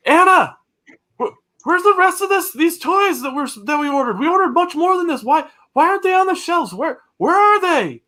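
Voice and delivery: upset store owner yelling for his clerk; yelling to Anna. Acted. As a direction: call out in alarm to the back room. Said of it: "he's yelling to Anna".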